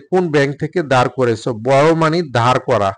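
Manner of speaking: ordinary talk, nothing marked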